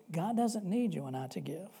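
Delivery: normal talking voice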